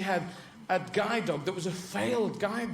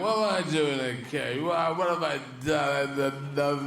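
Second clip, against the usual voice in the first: drawn-out, sing-song whine; slow canine lament, words stretched out; bewildered caged greyhound; 2 semitones lower, slower than usual. Acted. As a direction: wail slowly like a baffled dog. Greyhound wailing about being caged.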